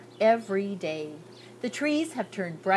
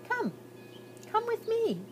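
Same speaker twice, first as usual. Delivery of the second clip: much higher, light beckoning; a little girl waving him along; inviting girl voice; 3 dB quieter than usual. A narrator's reading. Girl beckoning the hidden boy, high and light.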